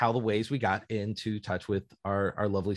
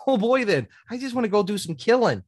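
eager rogue; 12 semitones higher, 5 dB louder than usual. Retold or imitated. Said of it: "I can be like".

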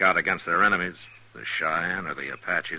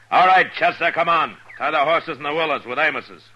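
loud, higher call across the distance; 9 semitones higher, 9 dB louder than usual. Acted. Marshal calls his deputy over.

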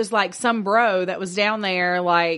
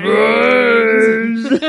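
louder groaning zombie moan; 8 dB louder than usual. Pretend. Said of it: "[name] likes to play zombie".

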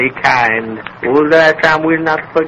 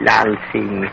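low, soft scornful chuckle; old fakir laughing at the wife's threat; mocking laugh; 4 semitones lower, 6 dB quieter than usual. Fakir mocks the servant.

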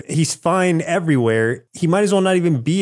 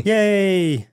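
mock yay; 7 semitones higher than usual. Joining the mock cheer.

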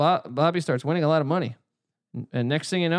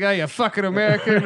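lightly imitated Irish accent, a bit higher; 5 semitones higher, 4 dB louder than usual. Local guy challenging an American, mock Irish accent. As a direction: put on a hint of an Irish accent. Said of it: "I like my Irish accent".

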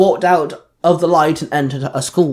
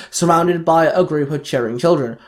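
usual speaking voice

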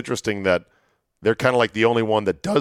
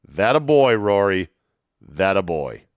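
ordinary talk, nothing marked

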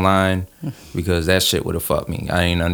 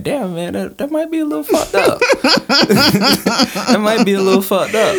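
high, laughing quote; laughing self-realisation; 3 dB louder than usual. Retold or imitated. Telling his friend about the latte mix-up, laughing. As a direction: crack up while admitting it. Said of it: "it's like".

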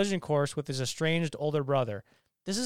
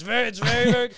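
louder, higher snatch of the braggart voice; impression voice demo; 6 semitones higher, 6 dB louder, faster than usual. Shown as a demonstration. Co-host shows the voice he lost, braggart impression. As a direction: briefly put the impression back on. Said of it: "like, the voice".

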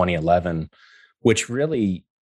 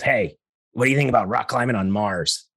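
a hint of a nasal voice; lightly pinched nasal voice; 4 semitones higher than usual. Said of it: "this nasal voice goes".

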